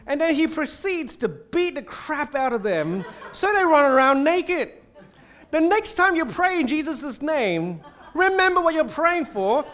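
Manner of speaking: preacher cracking up at the story while he warns the church; laughing. Real, not acted. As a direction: laugh through the punchline of the story.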